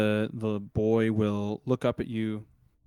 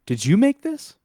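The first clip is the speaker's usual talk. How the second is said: high, loud, stammered disbelief; amazed boy; 8 semitones higher, 11 dB louder than usual. Acted. Boy touches the grown workbench and asks its maker, high and amazed. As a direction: ask it in wonder, voice jumping up. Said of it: "to make sure he's not seeing things".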